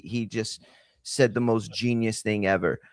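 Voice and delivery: ordinary delivery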